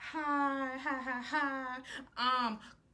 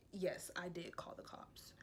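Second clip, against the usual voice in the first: unvoiced hushed whisper; guilty whisper to the cops; whispered confession; 6 semitones lower, 15 dB quieter than usual. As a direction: breathe it out, barely voiced. Retold girl's whispered confession.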